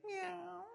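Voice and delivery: high, thin imitated cat meow. Preacher answering his own question with a high meow. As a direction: mew like a cat for the crowd. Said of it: "what noise do cats make?".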